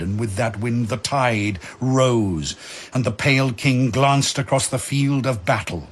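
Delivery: ordinary talk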